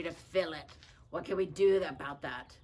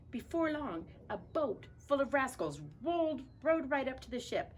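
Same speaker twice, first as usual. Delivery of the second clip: high narrator; 8 semitones higher than usual. Pretend.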